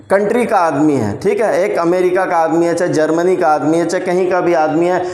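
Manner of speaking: ordinary delivery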